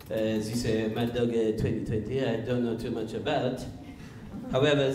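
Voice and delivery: put-on wine taster with a thick French accent. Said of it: "one of my French friends".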